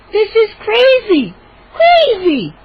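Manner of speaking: high, slow, near-tearful protest; outraged sister. Acted. Mindy protesting to the gnomes. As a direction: cry out in disbelief. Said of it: "Mindy cried".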